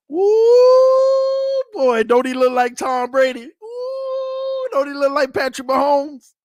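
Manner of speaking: high, loud whooping hype; hype excitement. Real, not acted. Vlogger hypes his quarterback's workout video to viewers, whooping high and loud. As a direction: gush over the quarterback like a fan.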